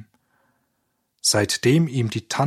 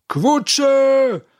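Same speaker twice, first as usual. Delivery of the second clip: high, loud classroom taunt; schoolboys' mocking yell; jeering shout; 6 dB louder than usual. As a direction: yell the nickname in a high voice, drawing out the end. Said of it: "schrien sie durcheinander".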